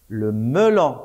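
ordinary delivery

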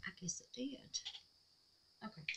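whisper. Real, not acted. Murmuring to herself.

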